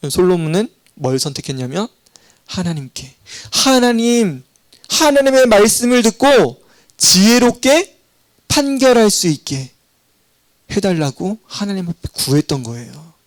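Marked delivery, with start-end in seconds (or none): none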